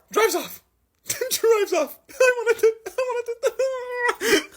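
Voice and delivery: very high, laughing squeak; high stretched laughing; laughing in disbelief. Real, not acted. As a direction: laugh through the words. Laughing at absurdity.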